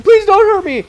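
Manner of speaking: high, loud panicky begging; mock terrified pleading. Clerk begging the robber not to hurt him, mock panic.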